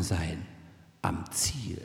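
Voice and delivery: very low, fading into a whisper; hushed arrival. Real, not acted. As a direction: let the voice sink away to a near whisper. Andächtiges Ankommen am Lebensende. (andächtig leise, fast geflüstert)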